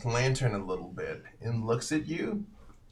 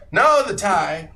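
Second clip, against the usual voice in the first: higher and louder, slow; old man insisting; 8 semitones higher, 10 dB louder, slower than usual. Acted. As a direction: firmly correct him. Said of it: "He's like".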